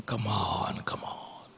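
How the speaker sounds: soft, low call fading to a whisper; hushed coaxing. Retold or imitated. Preacher coaxing him at the altar, hushed and low. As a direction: imitate a preacher's breath-soft urging.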